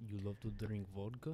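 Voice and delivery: questioning in a put-on Russian accent. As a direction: echo it as a mock Russian. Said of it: "very deep Moscovian tone".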